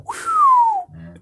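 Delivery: long high whistle. Real, not acted. Whistles at a thought.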